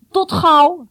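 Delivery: high, loud answering call. A narrator's reading. Boy aboard answers his waving friend, calling out. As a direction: shout a farewell back across the water. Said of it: "riep ook hij".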